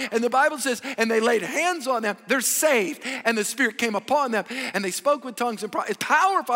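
loud, high, fervent preaching; testimony preached loud and high; full-force preaching. Acted. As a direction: preach it out to the whole room.

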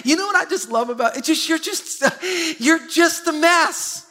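high, slow and laughing, mimicking the lady; laughing punchline. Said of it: "She goes".

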